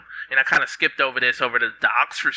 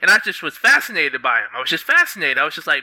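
usual speaking voice

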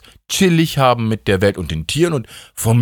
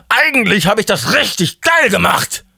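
euphoric boast; 11 semitones higher than usual. Acted. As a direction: crow it in manic self-congratulation. God repeats self-praise, far higher. (euphorisch überdreht, hoch und gedehnt)

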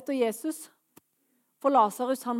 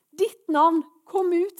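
raised, higher-pitched summons; calling out; 6 semitones higher, 7 dB louder, slower than usual. Acted. Preacher voicing Jesus calling to people in darkness, calling out. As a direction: call them out by name with authority. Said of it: "Men i dag roper Jesus".

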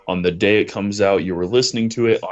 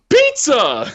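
high, louder delighted cry of Pizza!; excited shout; 11 semitones higher, 5 dB louder than usual. Retold or imitated. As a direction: burst out like a kid seeing a treat. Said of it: "All he hears".

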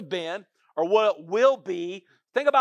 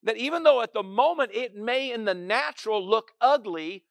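normal talking voice

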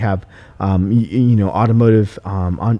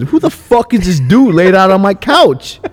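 slow, loud and high mock outrage; offended; 10 semitones higher, 7 dB louder than usual. Owner reliving finding a stranger on his office couch, slow loud mock outrage. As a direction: ham up the indignation, drawn out. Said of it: "at first I was a little offended".

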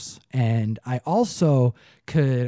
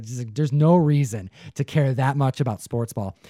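ordinary talk

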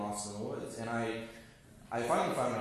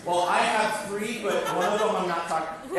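put-on bragging kid; 6 semitones higher, 7 dB louder than usual.

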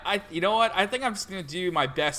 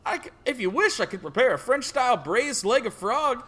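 higher, eager farmer servant; offering the frog dish, country style; 5 semitones higher, 3 dB louder than usual. Acted. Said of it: "farmer stereotype impression".

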